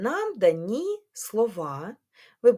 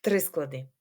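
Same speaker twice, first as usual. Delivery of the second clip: normal talking voice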